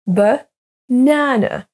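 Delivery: "Banana" slowly as buh... NA-na; second-syllable stress. Shown as a demonstration. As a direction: stretch the middle of "Banana". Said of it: "s'accentue sur la deuxième syllabe".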